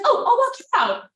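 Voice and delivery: high, loud imitation of an eager date; mock-eager date. Imitating date offering to walk her out, eagerly. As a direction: pitch it up as the keen date. Date's offer acted in story.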